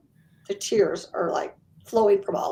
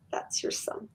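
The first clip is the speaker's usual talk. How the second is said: whispered reveal; 13 dB quieter than usual. Retold or imitated. Medium softly confirms to client.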